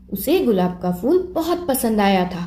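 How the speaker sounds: ordinary delivery, nothing marked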